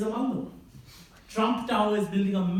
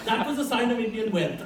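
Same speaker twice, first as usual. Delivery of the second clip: ordinary talk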